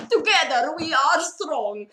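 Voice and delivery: all-out determined battle cry; high, loud, slow and forceful; Zarya. Retold or imitated. Zarya cosplayer saying her voice line, utterly determined. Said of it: "three hundred percent determination".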